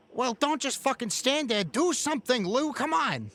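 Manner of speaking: high, pushy bark at his partner; urgent thug Vic. Acted. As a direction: hurry him along, annoyed. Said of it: "and he says".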